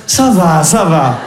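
mother's guilt voice. Retold or imitated. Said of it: "elle change sa voix".

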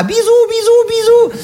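squeaky falsetto bisous bisous; mock kisses. Polar bear in a high squeaky voice blowing kisses to the journalist. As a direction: blow kisses in a tiny high cartoon voice.

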